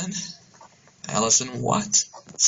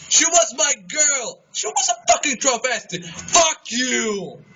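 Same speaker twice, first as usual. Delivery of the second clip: angry shout; 7 semitones higher than usual. Acted. Argument with friend.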